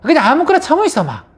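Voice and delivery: fast, high, loud acted retort; wife snapping back. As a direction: fire back at a rude husband. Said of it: "이렇게 하면 부인은". (맞받아 쏘아붙이는 부인 말투)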